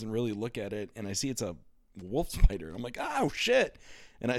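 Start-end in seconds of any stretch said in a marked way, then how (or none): 3.0-3.8 high-pitched startled cry; startled alarm. Retold or imitated. Child spotting a spider in bed, startled alarm. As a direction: yelp it in sudden alarm, acted out.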